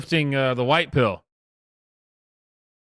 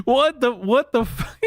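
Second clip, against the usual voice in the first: laughing; 13 semitones higher, 3 dB louder than usual. Real, not acted. Laughing reaction to clip.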